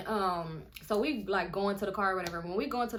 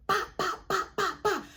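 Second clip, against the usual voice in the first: high, sharp popping noises; imitated gunshots; 11 semitones higher, 4 dB louder than usual. Storyteller imitating shots at the section, high popping. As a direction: pop out mimicked gunfire. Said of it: "you hear him".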